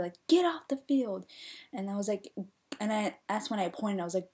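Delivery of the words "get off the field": mild mock coach yell; raised, louder imitation of a coach ordering a player off; retold yell. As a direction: bark it like a coach, half-strength. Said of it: "her coach yelled at her".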